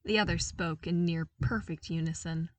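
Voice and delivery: usual speaking voice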